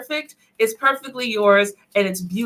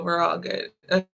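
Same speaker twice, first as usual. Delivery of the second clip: ordinary delivery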